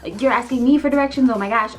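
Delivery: playfully flustered plea for a moment; flustered stalling. Acted. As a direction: act put on the spot and scrambling. Acting flustered, stalling for time.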